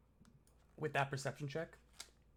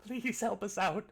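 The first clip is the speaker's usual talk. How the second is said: high, desperate begging through sobs; sobbing plea; 7 semitones higher, 4 dB louder than usual. Acted. Chained prisoner sobbing to his would-be rescuers, desperate pleading. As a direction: beg for rescue, voice pitched up and pleading. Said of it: "sobbing and just says".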